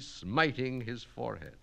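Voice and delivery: ordinary delivery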